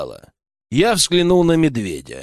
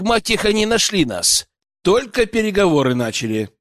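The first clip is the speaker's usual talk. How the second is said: ordinary delivery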